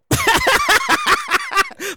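high, rapid run of laughter; laughing. Real, not acted. Host cracking up at being broke, high laughter. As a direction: burst into a high cackling laugh.

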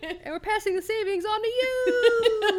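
high, loud laughing ad pitch; car ad pitchman. Pretend. Hosts parodying a car dealership ad, loud hammed-up pitch. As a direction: hype it like a used car commercial.

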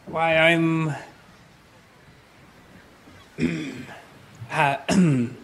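lightly posh stranger in a tavern; a touch of polite, lofty adventurer; genteel traveler voice. Acted. Player voices his character in-scene.

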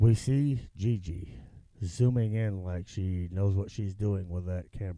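deep whispery wildlife narration; nature documentary. Pretend. Joins the mock documentary narration.

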